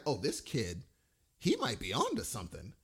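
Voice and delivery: low, soft aside to oneself; quiet retold musing. Fan recalling his thought about this kid, quiet musing. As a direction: drop low and soft, privately impressed. Retold first impression of young actor.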